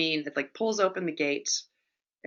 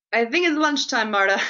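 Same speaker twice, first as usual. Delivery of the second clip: higher, louder eager joke; eager hunger; 4 semitones higher, 5 dB louder than usual. Retold or imitated. Audience member to Marta, eager and hungry. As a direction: sound suddenly hungry. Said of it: "you're like".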